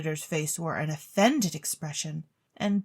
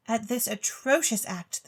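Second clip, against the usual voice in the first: usual speaking voice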